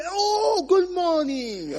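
high, drawn-out delighted greeting; exaggerated sing-out hello; hammed-up greeting. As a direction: imitate an effusive greeter, slow and high. Imitating response to shouted greeting.

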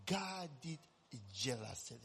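quiet, grave divine words; God's words retold gently and solemnly. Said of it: "And God said".

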